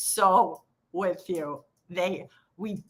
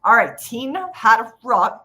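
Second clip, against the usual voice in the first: ordinary talk, nothing marked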